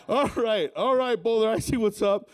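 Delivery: usual speaking voice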